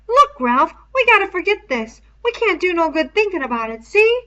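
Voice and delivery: put-on high, loud boyish voice, urgent; Piggy's high pleading voice. A narrator's reading. Piggy urges Ralph to forget.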